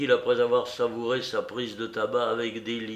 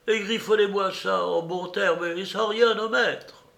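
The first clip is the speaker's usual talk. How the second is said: put-on high-pitched, emphatic old beggar; 9 semitones higher, 5 dB louder than usual. Beggar dictating his will to the notary, high imperious voice.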